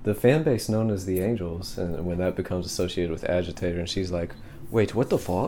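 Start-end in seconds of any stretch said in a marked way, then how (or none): 4.7-5.5 badly faked British woman; terrible British female accent. Podcaster as the confused singer, terrible British female accent. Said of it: "that was my terrible, terrible British female accent".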